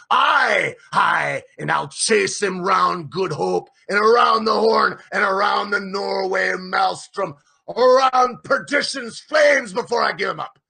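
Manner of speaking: loud high vow; shouting. A narrator's reading. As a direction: roar the oath to the crew. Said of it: "he shouted".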